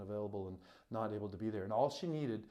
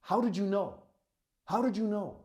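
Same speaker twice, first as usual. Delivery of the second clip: higher, louder amazed demand; urgent wonder, pitched up; imitated astonished question; 8 semitones higher, 4 dB louder than usual. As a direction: act out her stunned disbelief. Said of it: "she looked at me and she goes".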